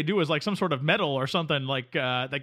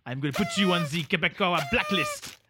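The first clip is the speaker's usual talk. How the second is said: ordinary talk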